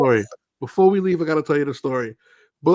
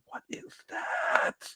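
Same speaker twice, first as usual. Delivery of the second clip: high, slow, breathless whisper; awed whisper; 12 dB quieter, much slower than usual. Retold or imitated. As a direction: gasp it in awe. Awed reaction to art.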